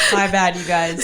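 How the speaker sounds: joking sorry, breaking up with laughs; laughing mock apology. Joking apology amid shared laughter.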